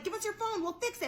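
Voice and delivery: hammed-up helpful scammer; mock scammer boys.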